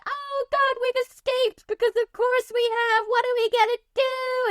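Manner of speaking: put-on high, frantic kid voice; panicked orphans. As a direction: play a flustered little orphan. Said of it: "the kids are like".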